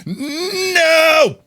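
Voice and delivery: high, loud cry of dismay; real dismayed shout. Real, not acted. Streamer crashing in game, sudden high no. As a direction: yelp in frustration. Face into a wall.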